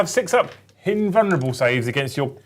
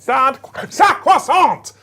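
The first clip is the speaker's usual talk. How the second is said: high, stretched French-accented shout; mock French accent; 9 semitones higher, 5 dB louder, much slower than usual. Shown as a demonstration. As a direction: show off the name in over-the-top French. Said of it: "French accent".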